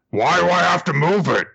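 high, loud alien whine. Acted. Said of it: "the alien says".

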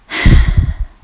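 loud exasperated sigh; hammed-up weary sigh; heavy sigh. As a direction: let out a big fed-up sigh. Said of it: "And mine was".

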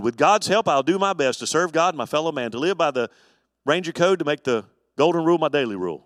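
a brisk, loud recitation of the Ranger code; recited pledge. Shown as a demonstration. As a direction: rattle it off like a boy scout oath. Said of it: "or something like that".

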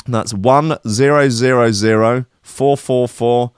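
slow, spaced-out number reading, announcer voice; reading out faulty batch codes like lottery numbers, slow and grand; lottery number call. Pretend. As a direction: call each digit like a lottery ball. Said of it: "like reading out lottery numbers".

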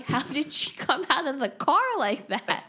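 high-pitched mock-baffled outcry; retold incredulity. Recalling a baffling film stunt, incredulous. Quoting own baffled reaction to scene.

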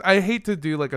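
ordinary talk

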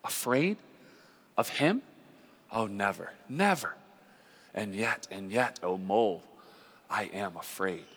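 soft, awed confession to a friend; murmuring, loving awe. A narrator's reading. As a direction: murmur it tenderly, with pauses, fading to very soft. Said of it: "murmured the rat, his eyes shining with unutterable love".

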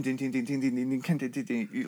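quiet rapid vocal dings; cymbal imitation. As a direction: tick off the ride pattern softly. Imitating the fast right hand.

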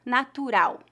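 "natural" as na-tu-RAU, stress on the last syllable and the final L like U; Portuguese pronunciation. Shown as a demonstration. Teacher models the Portuguese word for learners to repeat, clear Portuguese pronunciation. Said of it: "a letra L é pronunciada como U".

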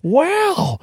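high-pitched impressed onlooker; mock amazement. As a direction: gush in exaggerated awe, pitched high. Acts out impressed beachgoers hearing the accent.

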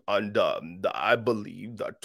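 mock preacher dropping low and soft; put-on deep voice. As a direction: fake a deep, spiritual-sounding voice. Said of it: "you made your voice deep".

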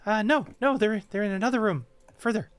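high, jittery fae voice; squeaky, wound-up pixie; frantic tiny creature. Acted. Quickling voice.

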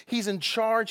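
normal talking voice

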